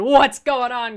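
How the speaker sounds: lightly mimicked channel opener; streamer's intro. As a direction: punch it out like a YouTube intro. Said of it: "you've got Muse out going".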